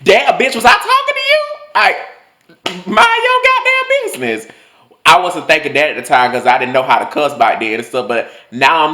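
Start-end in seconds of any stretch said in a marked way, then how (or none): 0.0-4.5 very high, loud indignant screech; mock outraged retort. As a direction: snap back in hammed-up outrage. Imagined angry retort to teacher.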